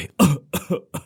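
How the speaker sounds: sharp, pained coughing fit; wounded cough. Acted. Injured fortune teller coughing mid-sentence, sharp and pained. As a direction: cough as if blood is in the throat.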